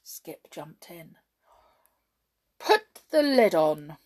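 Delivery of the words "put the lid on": loud, slow command. A narrator's reading. Hop commanding Jump, slow loud order. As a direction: give the order grandly. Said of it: "Hop commanded Jump".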